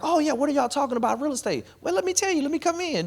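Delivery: high, playful voice for a guest chiming in; mock casual chat. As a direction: play the eager newcomer joining the group. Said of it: "you was like".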